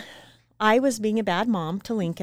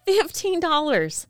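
outraged; 7 semitones higher, slower than usual. Real, not acted. Repeats price in outrage.

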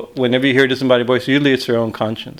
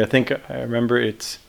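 ordinary delivery

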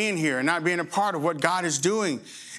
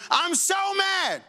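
high, slow mock whine of anger; mock outrage; 9 semitones higher, slower than usual. Preacher mimics angry rioters, slow high mock whine. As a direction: drag it out like a sulking rioter. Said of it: "and they're going".

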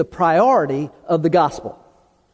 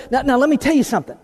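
fast, higher, pointed lecture; emphatic mock warning; 5 semitones higher, much faster than usual. Preacher playing someone insisting you listen, fast and higher. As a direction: rattle it off like a wagging finger. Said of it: "It'd be like, so".

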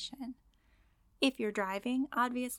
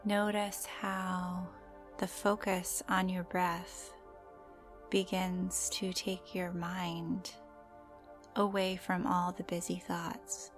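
guided meditation; slower than usual. Acted. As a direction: gently guide the listener into calm.

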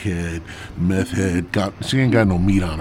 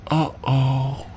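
woman's voice; 4 semitones higher than usual. Retold or imitated. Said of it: "she's like".

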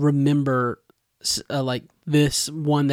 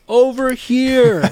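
high, drawn-out mock yell; stretched-out imitation of a distant shout; imitating a yell; 9 semitones higher, 4 dB louder, much slower than usual. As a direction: call out from afar as the character, pitched well up. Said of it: "Zeke yells from the edge".

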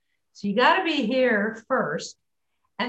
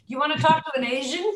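laughing mock question; 6 semitones higher, faster than usual.

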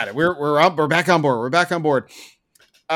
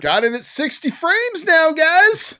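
sarcastic chirpy announcement, pitched up; mock developer brag; 8 semitones higher, slower than usual. Ranting gamer mocks the developers in a sarcastic chirpy voice. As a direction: parody a smug studio update.

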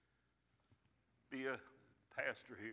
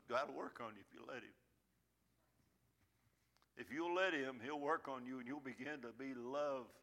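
normal talking voice

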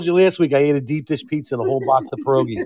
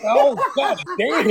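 laughing; 10 semitones higher, much slower than usual. Real, not acted. Reacting to joke.

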